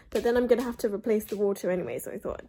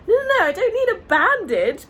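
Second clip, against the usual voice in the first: flustered protest; 10 semitones higher, 8 dB louder than usual. Retold or imitated. Confused child refusing a bandage.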